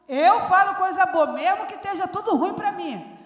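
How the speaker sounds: loud, driving pulpit preaching; fiery preaching. Acted. Pastor rallying the church with loud, driving preaching. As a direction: preach it to the back row, full force. Preaching full force again.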